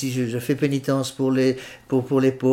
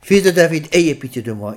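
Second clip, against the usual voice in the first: louder, higher returning cry; insistent plea; 3 semitones higher, 5 dB louder than usual. Shown as a demonstration. The faithful begging God again when unheard, insistent plea. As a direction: call again, pressing harder. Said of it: "il faut revenir".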